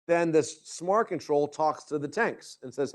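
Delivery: normal talking voice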